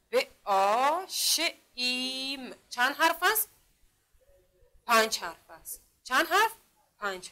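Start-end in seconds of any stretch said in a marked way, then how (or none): 0.1-2.6 ب، آ، ش، ایم sound by sound, very slow; separated letter sounds ب، آ، ش، ایم with long vowels. Shown as a demonstration. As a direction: stretch آ and ایم in ب، آ، ش، ایم. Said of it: "اگر این را صدا کشی کنیم".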